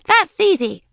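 high, loud confident retort; witchy boast. A narrator's reading. Daisy as witch answering princess, loud and cocky. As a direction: toss back a loud boast.

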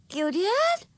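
put-on very high, childlike wondering question; fascinated. A narrator's reading. Ramona hearing about her younger self, fascinated. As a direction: pipe up high with fresh interest. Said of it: "fascinated with this bit of information".

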